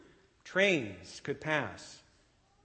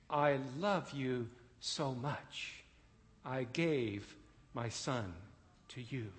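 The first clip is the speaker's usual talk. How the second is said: slow, much softer, tender close; tender God; 6 dB quieter, slower than usual. Acted. Preacher voicing God's love to listeners, soft and slow. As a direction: let the love settle gently, slowly. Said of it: "God is asking us the same question".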